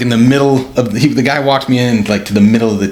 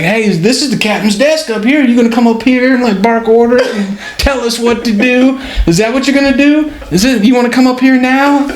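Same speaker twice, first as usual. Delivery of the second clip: ribbing the captain in a raised jeering voice; mocking taunt; 11 semitones higher than usual. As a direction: goad the new captain, voice pitched up high. [name] taunting new captain.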